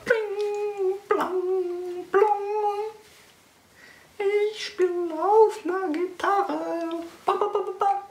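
squeaky sung guitar sounds; high guitar noises. Pretend.

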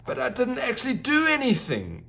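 a unicorn brushing off praise; lightly voiced unicorn exclaiming; exclaimed protest. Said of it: "exclaimed Alchemy".

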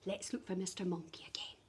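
quick soft whisper. Acted. Teacher whispers to children before searching again. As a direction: hush the class into searching.

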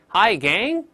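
Hi Gang said slowly, rising on Gang; slow demo. Shown as a demonstration. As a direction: ease into Hi Gang slowly, lifting Gang. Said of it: "慢慢来". (慢慢领读Hi Gang)

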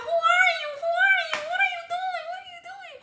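high, shrill mock panic; panicked friend. The friend cries out as her friend gets beaten. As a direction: imitate the friend freaking out.

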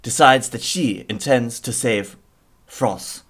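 hammed-up French accent, dramatic narration; an intro narrator with a put-on French accent. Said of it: "how French was that accent?".

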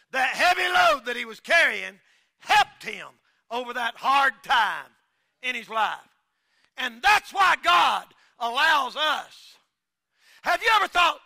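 shouting sermon climax; preaching at full force. Acted. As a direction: preach it hard to the congregation.

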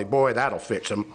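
low, slow deadpan punchline; wry, drawn-out sarcastic aside; dry sarcasm. Real, not acted. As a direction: drop it low and slow, mock-confident. Sarcastic punchline to sidewalk sign analogy.

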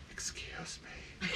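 slow, drawn-out whispered imitation; mock whisper. Imitating co-host excusing a burp in someone's ear, whispered. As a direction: whisper it slowly, mimicking a sneaky apology. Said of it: "You whispered it".

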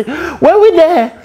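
very high, drawn-out imitated cry; mock confused question. Sleeper calling from the back of the taxi, slow high mock confusion. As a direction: stretch it out slowly in a high voice.

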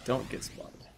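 unvoiced whisper to himself. Real, not acted. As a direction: whisper it like sneaking past. Sneaking as spy.

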